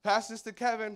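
high, loud family call; mock call. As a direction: call it across the airport. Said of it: "they're like".

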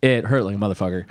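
ordinary talk, nothing marked

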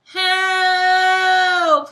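very loud, high scream; cry for help. A narrator's reading. Uncle Ned crying out as dragonfly lands, loud. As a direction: scream it in panic. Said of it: "he yelled".

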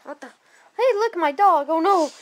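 ordinary delivery

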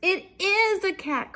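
high, loud exclamation; panicked storybook mouse; alarmed cry. A narrator's reading. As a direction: cry out the terrible discovery. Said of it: "cried Melissa".

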